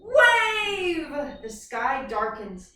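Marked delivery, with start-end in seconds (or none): 0.0-1.1 big excited shout; shouted wave. Acted. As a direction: call it out like a crashing wave. Teacher acting story wave.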